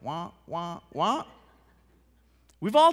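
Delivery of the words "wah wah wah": muted trombone wah wah; droning wah-wah noise; Charlie Brown teacher. Retold or imitated. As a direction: imitate the adult voice in Charlie Brown. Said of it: "Ever seen Charlie Brown?".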